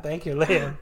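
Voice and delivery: normal talking voice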